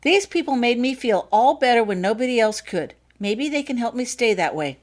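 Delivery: put-on a young girl asking her mother to let her go; eager little girl. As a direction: speak up bright and keen like a small girl. Child voiced in story.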